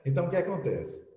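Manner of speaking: ordinary talk, nothing marked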